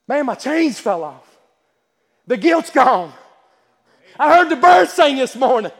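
building from slow and level to loud, high joy; new convert rejoicing that his guilt is gone; joyful release. Retold or imitated. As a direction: let the relief swell into a shout. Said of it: "and I just thought".

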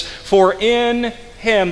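"in him" dit lentement, aigu et appuyé; pèse chaque mot, "in him" étiré; stress on in him. Shown as a demonstration. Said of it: "are words for emphasis".

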